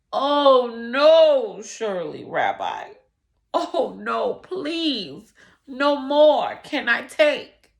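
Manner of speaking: loud, pleading outcry; crying out. Acted. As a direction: protest loudly in despair. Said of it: "cried the man".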